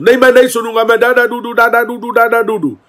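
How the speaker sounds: put-on high, loud childish ranting; mock a tantrum with gibberish; mocking angry yell. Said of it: "जिस लहजे में, जिस level में, जिस टोन में बात हुई". (नकली गुस्से में बड़बड़ाकर चिल्लाना)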